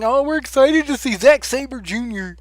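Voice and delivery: a touch of gushing fan voice; mocking fans thrilled about Zack Sabre Junior; mock excited fans. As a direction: lightly gush like an eager fan. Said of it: "everybody saying".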